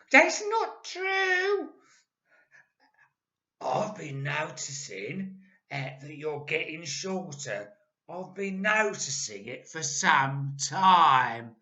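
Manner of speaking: put-on loud, slow, solemn old man; mock-serious Mister Twit. A narrator's reading. Husband faking worry to scare wife, slow and loud. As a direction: pretend grave concern. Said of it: "said Mister Twit".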